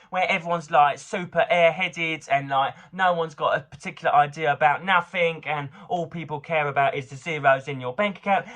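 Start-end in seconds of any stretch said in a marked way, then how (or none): 0.7-5.4 mock Essex airhead. Lampooning Essex folk while listing accents, in a ditzy mock Essex accent. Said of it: "then you've got Essex".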